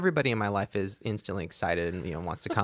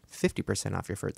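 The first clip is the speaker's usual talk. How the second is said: ordinary talk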